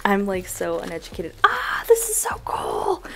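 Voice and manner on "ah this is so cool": awed whisper. Real, not acted. Unboxing a gift.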